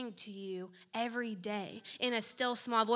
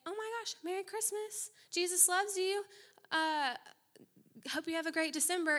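much higher, chirpy holiday cheer; bubbly mock neighbor; 8 semitones higher than usual. Preacher playing a cheery pie-bearing neighbor, bubbly and high. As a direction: beam at the neighbor's door.

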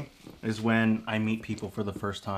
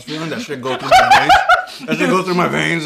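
laughing; 5 semitones higher, 9 dB louder than usual. Real, not acted. Joking about loving IVs.

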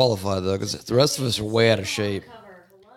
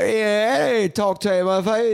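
higher, hammed-up Cockney accent; half-joking Cockney impression; mock Cockney; 9 semitones higher than usual. Shown as a demonstration. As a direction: lay on a rough London Cockney. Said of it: "Cockney's kinda like".

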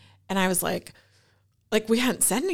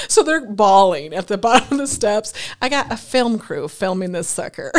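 laughing; 3 semitones higher, 7 dB louder than usual. Real, not acted. Laughing while recounting crying kids.

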